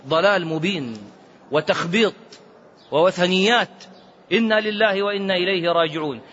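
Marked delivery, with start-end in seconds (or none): none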